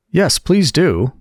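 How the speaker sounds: slow, high and pleading. A narrator's reading. Alice to the Dormouse, pleading for a story. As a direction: beg sweetly for the story. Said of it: "pleaded Alice".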